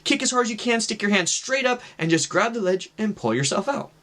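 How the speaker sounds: lightly acted encouraging instructor; retold coaching voice. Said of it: "the voice is telling me".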